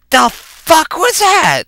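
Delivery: high, slow, drawn-out disbelief; acted-out stunned indignation; incredulous outburst. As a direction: stretch it out in bafflement. Bewildered reaction after quarrel.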